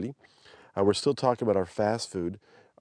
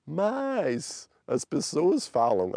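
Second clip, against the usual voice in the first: high, drawn-out mimicry of her intonation; imitating soaring pitch; 6 semitones higher, much slower than usual. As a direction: climb high on "mas" and stretch it out. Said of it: "how you got up there".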